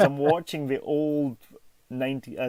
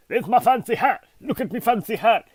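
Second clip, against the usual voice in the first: mock posh showing off; 10 semitones higher, 7 dB louder than usual. Shows off fancy hat.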